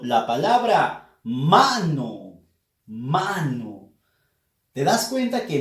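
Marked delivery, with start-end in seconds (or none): 1.2-2.4 "mano" drawn out, MA emphasized; teacher demonstrating the stressed MA in "mano". Shown as a demonstration. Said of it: "se pronuncia con más énfasis". 2.9-3.9 "mano" with a long MA, falling away low; "mano" said slowly to show its stressed MA. Shown as a demonstration. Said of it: "se pronuncia con más énfasis".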